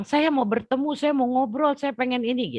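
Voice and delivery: very high, loud anguished cry; screaming plea. Retold or imitated. Desperate woman begging to meet God, very high anguished scream. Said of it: "Saya berjerit-jerit gitu".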